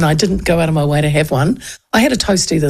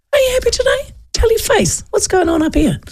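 very high sing-song scolding; high cheeky saying; playful saying. Shown as a demonstration. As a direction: tease a sulky face, pitched up high. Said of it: "one of my favourite sayings".

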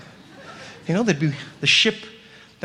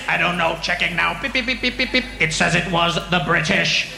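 alien computer voice; 2 semitones lower than usual. Retold or imitated. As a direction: read out the scan flatly. Alien checking scanner.

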